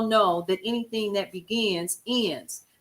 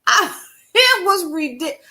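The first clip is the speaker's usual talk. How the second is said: laughing; 7 semitones higher, 5 dB louder than usual. Real, not acted. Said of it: "laughing at the memory".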